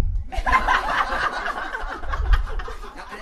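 breathy laugh. Acted. Guru laughs at denial.

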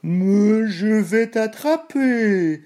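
high, slow mooing cry; irritated cow's cry. A narrator's reading. Cow irritated by the biscuit's attitude, long mooing cry. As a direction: stretch it into an annoyed moo. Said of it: "cria la vache irritée".

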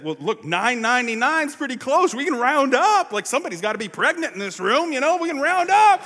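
a bouncy, loud usher talking the pastor into it; eager usher. Retold or imitated. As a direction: sell the idea of rounding up. Usher urging to round up.

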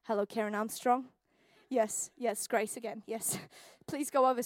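lightly voiced mum, low and quiet; mum on the phone. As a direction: make a subdued, resigned-sounding call for help. Acts mum's phone call.

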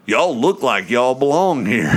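a brash New York guy calling out; New York accent. Retold or imitated. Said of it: "typical New York accent".

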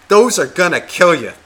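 a child telling off a smoker, mock yell; mock kid yell. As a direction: wag a finger and scold it like a little kid. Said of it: "And he just yelled".

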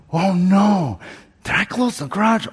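imitating himself panicking while driving off; retold panic. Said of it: "I panicked again".

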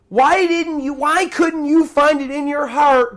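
high, impassioned reproach, drawn out at first; acted divine indignation, pitched high; stern rebuke. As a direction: rebuke as the wronged master, building in force. Acting God rebuking unforgiving servant.

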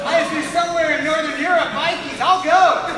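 loud, high, reckless enthusiasm; volunteer at full volume, stretching it out; eager shout. Retold or imitated. Continues acting out eager acceptance.

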